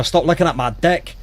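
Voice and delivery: cheeky amateur Scots; Scottish accent. Acted. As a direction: play up DECK in a Scottish accent. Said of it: "whenever I hear a Scottish accent".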